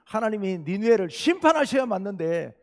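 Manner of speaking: loud, indignant protest to God; Jonah's angry outburst. Retold or imitated. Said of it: "성질을 있는 대로 내면서".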